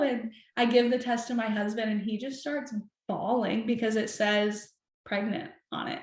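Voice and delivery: ordinary delivery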